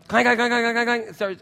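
high, loud mock clanking of a bad engine; engine clank imitation. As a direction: clank it out like a broken motor.

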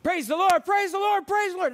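shouting the command high and loud; urgent shout. Acted. Rider yelling at his galloping horse, loud high shout. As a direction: yell it to speed the horse up. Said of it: "Let's open this horse up".

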